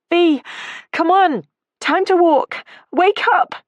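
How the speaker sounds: loud, high desperate yell. A narrator's reading. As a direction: yell to rouse her friend. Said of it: "she yelled at her friend".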